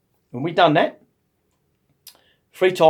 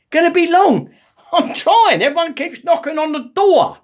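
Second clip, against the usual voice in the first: high, loud mock indignation; hammed-up flustered protest; exasperated outburst; 11 semitones higher, 4 dB louder than usual. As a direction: fling it back in comic desperation, pitch way up. Flustered man echoes nurse's question.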